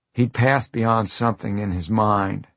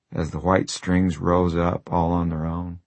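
ordinary delivery

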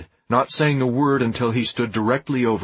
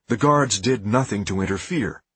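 ordinary delivery

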